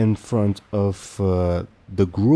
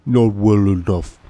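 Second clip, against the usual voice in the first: ogre chieftain; 4 dB louder than usual. Acted. Ogre leader judging Gunk.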